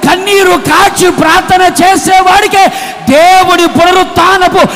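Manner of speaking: loud, high, driving sermon shout; full-force preaching. Real, not acted. Preacher promising the congregation Christ's appearance, shouted preaching. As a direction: thunder it out to the crowd.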